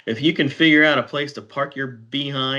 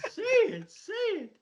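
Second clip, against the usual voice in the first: high-pitched voice of goading listeners, laughing; mock crowd urging; 4 dB louder, slower than usual. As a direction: egg them on in a high, laughing voice. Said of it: "Everybody online's going".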